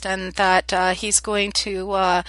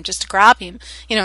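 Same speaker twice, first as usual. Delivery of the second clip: ordinary delivery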